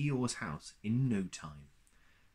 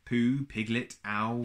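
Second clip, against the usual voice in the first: usual speaking voice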